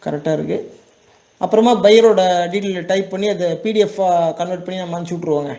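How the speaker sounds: normal talking voice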